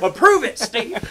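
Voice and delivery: high, loud pretend order; mock demanding shout. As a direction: boss him around at playful full volume. Pretend demanding phone call to Apple.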